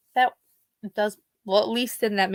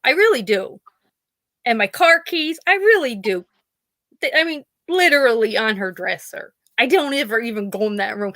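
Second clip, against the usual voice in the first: usual speaking voice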